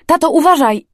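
high, loud warning cry; alarmed shout. A narrator's reading. Daughter warns dad of oncoming car, alarmed shout. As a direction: shout a sudden warning, pitch jumping. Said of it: "krzyknęłam".

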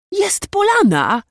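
high, loud, drawn-out happy cry; young nobleman's delighted yell; joyful shout. A narrator's reading. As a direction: call out the good news with joy. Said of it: "krzyknął radośnie młodszy szlachcic".

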